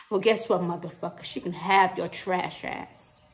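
ordinary delivery

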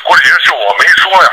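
ordinary delivery

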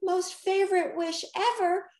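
high-pitched, doubtful character voice. A narrator's reading. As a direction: go along with it, but sound unconvinced. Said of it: "Rocky said doubtfully".

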